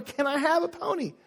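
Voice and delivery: put-on high, childish ask; mock child plea. Child asking for a pony, high voice. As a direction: plead like a kid. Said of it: "your child comes in and says".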